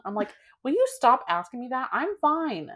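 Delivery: ordinary delivery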